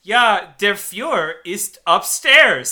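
halting beginner's German recitation; first-year German student. Retold or imitated. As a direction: read foreign words off the page one by one. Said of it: "like, oral exam in, like, first year German".

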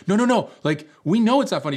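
high, loud mock backpedalling; hurried placating. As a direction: imitate friends protesting their innocence. Friends hurriedly placate the girl.

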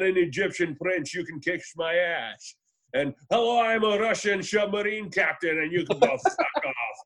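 slurred-sibilant Scottish movie-star impression; Sean Connery. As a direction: turn every S into a mock-Connery SH. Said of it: "It was, like, about Sean".

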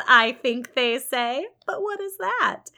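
loud, lilting teasing St John; a lightly voiced teasing clergyman; mock-offhand. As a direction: play down the fortune with mock indifference.

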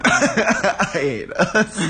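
said through slow laughter; laughing. Real, not acted. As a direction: laugh while saying it. Laughing at themselves.

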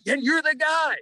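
high-pitched accusing punchline; retold recognition. Waiter to upset starter at dinner, high-pitched accusing. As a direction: shoot up high, imitating a waiter who recognises the culprit.

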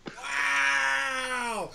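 long, drawn-out, loud wow; amazed wow. Real, not acted. Host reacts to guest's thin X-Men knowledge, amazed. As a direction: stretch it out in big amazement.

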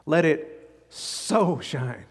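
"so" drawn out and leaned on, very slow; slow down and press the word "so"; stress on so. Shown as a demonstration. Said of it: "with an emphasis on the method".

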